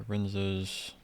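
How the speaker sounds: normal talking voice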